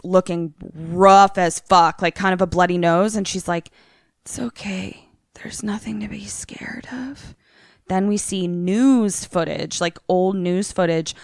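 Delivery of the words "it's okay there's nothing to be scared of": soft, creepy imitation whisper; eerie whispered reassurance. Bloody-nosed Grace soothing the kids, in an eerie whisper. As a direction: whisper comfort that sounds unsettling.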